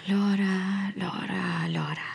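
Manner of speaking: repeating the name, dropping to a whisper; fading whispered repetition. Acted. Impostor drilling the name alone, fading to a whisper. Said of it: "masanay ako kaagad sa pangalang".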